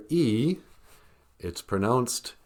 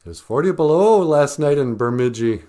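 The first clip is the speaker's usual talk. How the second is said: mock Minnesotan; 5 semitones higher, 6 dB louder than usual. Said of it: "They're like".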